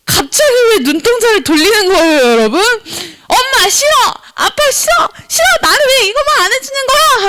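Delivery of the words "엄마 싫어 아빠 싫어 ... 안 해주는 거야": high-pitched mock tantrum; complaining child. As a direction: whine and protest like a child at the parents. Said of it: "엄마에게 불평". (높은 목소리로 떼쓰듯 불평)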